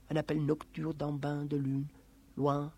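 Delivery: ordinary delivery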